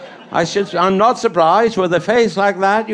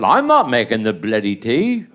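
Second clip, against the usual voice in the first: much lower, stubborn refusal; his own gruff refusal; 10 semitones lower than usual. Retold or imitated. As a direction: dig in low and stubborn on "not". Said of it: "I said".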